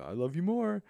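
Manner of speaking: high, sing-song rise on a loving comeback; playful one-upping. Retold or imitated. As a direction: tease lovingly, stretching up into a high note. Playful love-you contest with son.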